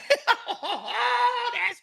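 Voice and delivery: high-pitched burst of laughter; real high laugh. Real, not acted. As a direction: burst out laughing at your own joke. Cracks up at his own joke.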